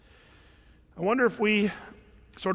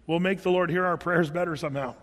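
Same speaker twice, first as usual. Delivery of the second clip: normal talking voice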